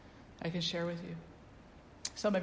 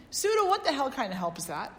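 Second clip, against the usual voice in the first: incredulous student; 6 semitones higher, 5 dB louder than usual. Retold or imitated. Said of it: "He said".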